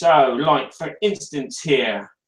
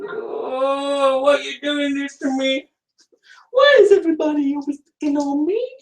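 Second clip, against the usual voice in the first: mock lament; 9 semitones higher than usual. Host pleading with broken camera, hammed-up high lament. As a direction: wail it high like a picked-on victim, hammed-up.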